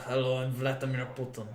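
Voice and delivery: put-on low, soft Russian accent; Vladimir Putin. Streamer asked by chat for a Russian voice, shaky Russian-accent attempt. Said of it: "Can you do a Russian voice?".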